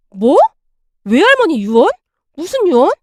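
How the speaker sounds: short, startled questions; taken aback, voice jumping up. A narrator's reading. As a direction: echo the news in rising disbelief. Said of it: "제가 놀라서 엄마를 바라봤는데요".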